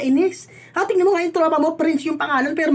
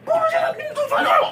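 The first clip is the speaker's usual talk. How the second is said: high loud crying yell; tearful shouting; 10 semitones higher, 6 dB louder than usual. Acted. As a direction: shriek through tears. Wife yelling, crying.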